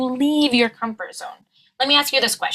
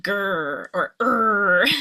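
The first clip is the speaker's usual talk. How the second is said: mock dog growl on a long R; mad dog growl; much slower than usual. Shown as a demonstration. Coach likens schwa R to a mad dog, growling lightly. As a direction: growl "grr" into a drawn-out "er". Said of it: "like a mad dog".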